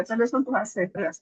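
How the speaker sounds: normal talking voice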